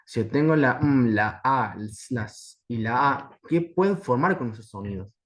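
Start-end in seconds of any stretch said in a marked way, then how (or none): 0.6-3.4 "la m, la a, la s y la a" with each letter voiced as its sound, a hummed m and hissed s; isolated phonemes. Shown as a demonstration. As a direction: sound out m, a, s, a separately in "la m, la a, la s y la a". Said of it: "Luego de escuchar sonidos aislados".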